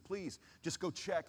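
high, slow imploring request; retold pleading. Crash survivor begging his friend to go look, retold pleading. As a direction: stretch it out as a retold plea.

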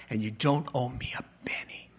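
fast soft whisper; forgiving whisper. Retold or imitated. King whispering to the debtor that he owes nothing. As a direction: hush it out gently.

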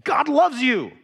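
emphatic repeat to a dismissive friend; insistent. Retold or imitated. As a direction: push back, make him listen. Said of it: "I'm like, No, listen".

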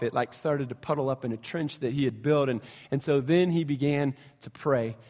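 normal talking voice

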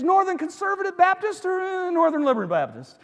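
high, loud, eager question; keyed-up comic questioning; escalating excitement. Acted. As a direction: press on with mounting hope.

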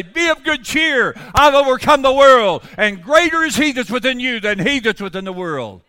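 high, full-force pulpit shout; shouted preaching climax. Real, not acted. Preacher quotes Jesus to congregation at the peak, shouting. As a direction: cry it out triumphantly to the congregation.

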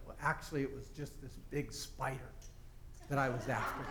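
soft, low, embarrassed admission; sheepish confession. Retold or imitated. Man explains the spider to police, sheepish and hushed. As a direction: own up quietly, voice dropped.

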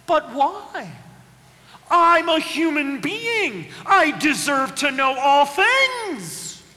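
hammed-up self-important child; mock indignant. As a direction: demand your right to know. Mock defiant human.

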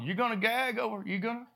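a hint of a mocking God; teasing Lord. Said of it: "hear the Lord up there going".

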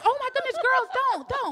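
high, alarmed coworkers pleading; high-pitched comic alarm; mock panic. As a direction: imitate frantic onlookers breaking up a fight. Coworkers panic, thinking sisters are fighting.

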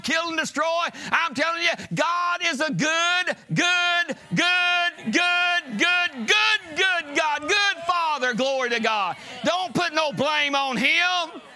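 high, loud, drawn-out preacher's shout; full-force preaching. Real, not acted. Preacher urging church not to blame God, full-force shout. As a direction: cry it out with long, hammered words.

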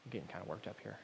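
soft, fast, offhand; husband telling his wife it's just his job, soft and offhand; dutiful, unfeeling husband. Pretend. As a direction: flatly shrug it off as duty. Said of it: "I'll say".

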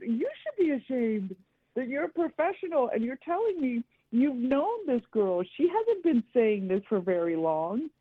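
high-pitched indignant outrage, no shouting; retold screaming match. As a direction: re-enact telling off the therapist, pitch up high. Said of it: "it turned into somewhat of a screaming match".

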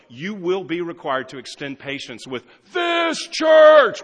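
loud, slow, driving preacher shout; emphatic warning. Real, not acted. Pastor warning new members, loud and slow. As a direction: press it hard on them.

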